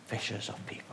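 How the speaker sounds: whispered, breath only. Retold or imitated. Jesus calling Simon, dropping to a whisper. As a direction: drop to a secret whisper.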